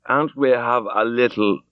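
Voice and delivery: slow, slurred thanks; thick drunken slur. Acted. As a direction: thank him through a drunk, heavy tongue. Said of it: "He spoke very thickly".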